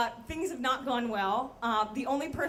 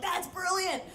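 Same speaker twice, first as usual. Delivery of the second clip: higher-pitched impressed exclamation; mock admiration; 6 semitones higher, slower than usual. Coworkers hearing the dealer's pound cake plan, mock admiration. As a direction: act out an awed group reply.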